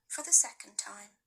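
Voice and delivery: ordinary delivery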